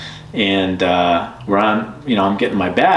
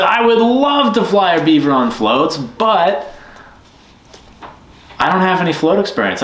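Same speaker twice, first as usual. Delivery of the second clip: excited reply; 8 semitones higher than usual. Retold or imitated. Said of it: "I said".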